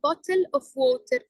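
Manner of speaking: "bottle of water" in an Arabic accent, every letter sounded. Shown as a demonstration. Teacher showing how Arabic speakers say it, Arabic accent. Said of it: "عم نلفظ كل حرف بحرفه".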